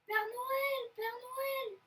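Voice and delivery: high, loud call; crying out. A narrator's reading. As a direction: call out in excited alarm. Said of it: "s'écrie la louve".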